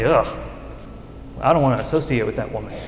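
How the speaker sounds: sniffy townswoman dismissing the outcast; disdainful aside. Retold or imitated. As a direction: brush her off with disgust under your breath. Town women snubbing the outcast.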